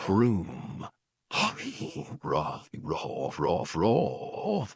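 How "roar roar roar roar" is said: low, soft growling roar; a rumbling monster roar; beastly roar. Acted. Beast roars as the room rises, low growl.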